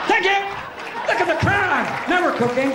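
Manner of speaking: high-pitched excited call to a crowd; hammed-up shrieky impression of a performer thanking the crowd; Joan of Arc. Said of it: "Joan of Arc".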